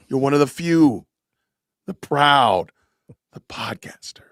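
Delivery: grand slogan fading to a whisper; mock solemn tribute. Host parodies a guest hailing Jared as a rare podcaster, mock solemn. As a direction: draw out the tribute, whisper the last word.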